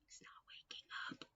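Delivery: hushed whisper. Acted. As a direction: whisper so he stays asleep. Reading to a child.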